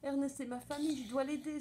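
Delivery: high-pitched distressed little mouse. A narrator's reading. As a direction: fret earnestly in a high small voice. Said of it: "se désole la petite souris". (désolation, petite voix aiguë)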